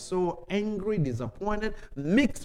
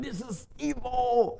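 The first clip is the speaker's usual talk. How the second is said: raised pitch, slow mock-outraged This is evil; angry pastor mother; 7 semitones higher, 4 dB quieter than usual. Pastor on the phone scolding him, a high slow scandalized voice. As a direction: imitate a scandalized mother figure, drawn out. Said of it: "She was so angry".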